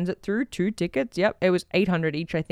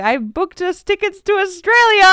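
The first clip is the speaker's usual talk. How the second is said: high, slow, sing-song tease; teasing announcement; 9 semitones higher than usual. Retold or imitated. Teasing her wife at the door with exciting news, high and slow. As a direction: draw out the big reveal.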